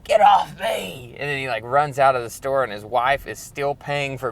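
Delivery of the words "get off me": high, slow retold yell, drawn out; pushing helpers away with a slow high yell; defiant shout. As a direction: bellow it at the firefighters. He was so defiant.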